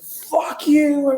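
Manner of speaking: high, strained imitation of yelling; mock yell. Retelling the husband's parking-lot row, high mock yell. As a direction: yell it in a high voice without full volume. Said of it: "he's yelling at the chick".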